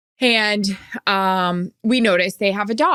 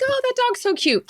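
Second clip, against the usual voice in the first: put-on much higher, sweet baby-talk coo; cooing at a dog.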